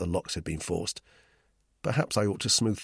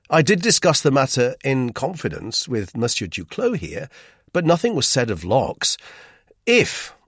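raised, firm explanation; severe; 5 semitones higher, 6 dB louder than usual. A narrator's reading. Narrator corrects Roux's misapprehension, severely. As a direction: set him straight severely, voice lifted. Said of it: "I went on severely".

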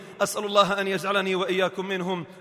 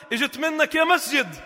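mock relief; 6 semitones higher than usual. Mocking stay-at-homes.

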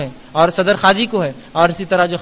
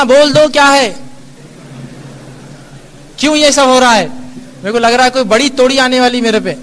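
exasperated husband, louder and higher; a husband giving in after days of treats; mock-exasperated husband voice; weary husband; 4 semitones higher, 3 dB louder than usual. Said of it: "کہ اس نے تھک کر بولنا".

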